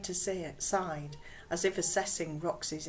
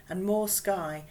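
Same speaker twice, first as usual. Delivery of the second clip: usual speaking voice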